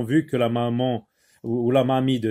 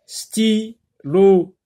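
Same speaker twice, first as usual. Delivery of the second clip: "Stylo" loud and higher, as sty, lo; split into two syllables; 5 semitones higher, 6 dB louder than usual. Shown as a demonstration. Teacher dividing the word for pupils, split into syllables. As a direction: pause between the two halves of "Stylo". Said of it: "deux syllabes".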